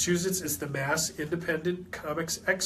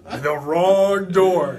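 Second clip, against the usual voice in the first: ominous; 7 dB louder, much slower than usual. Pretend. Mock ominous warning.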